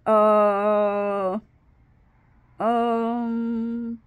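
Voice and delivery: long, drawn-out hesitation noises; awkward stalling. Retold or imitated. Asked where his girlfriend is, awkward stalling. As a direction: stretch it out, dreading the answer. Stalling before telling the boyfriend.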